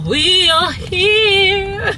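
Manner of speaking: high, loud, slow and choked up; overwhelmed arrival cry; tearful joy. Real, not acted. Arriving at the surprise, crying with joy.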